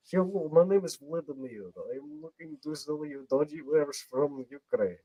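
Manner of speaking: hammed-up Russian-accented sales pitch. Shown as a demonstration. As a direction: do a Russian dodgy dealer. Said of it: "I can do a great Russian one".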